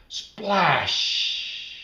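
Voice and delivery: loud, high sound word; splashy exclamation. A narrator's reading. Narrator acting the pool splash, high and loud. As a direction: pop the splash out.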